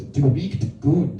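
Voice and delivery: slow, deliberate German "Du riecht gut"; German phrase. Retold or imitated. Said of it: "that's German for you smell nice".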